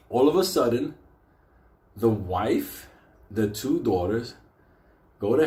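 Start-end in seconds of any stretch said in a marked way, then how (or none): none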